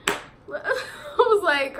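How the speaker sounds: real laugh breaking through the words, slowed right down. Real, not acted. Telling her friends about Ashley's threat, laughing. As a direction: laugh it out as you tell it. Laughing while retelling fight.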